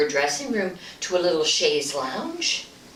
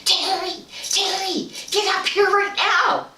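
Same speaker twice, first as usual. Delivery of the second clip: retold scream; 12 semitones higher than usual. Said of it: "he started screaming".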